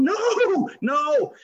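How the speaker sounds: high, strained cry of no no; panicked inner no. Retold or imitated. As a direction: inner voice begging no. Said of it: "inside I'm going".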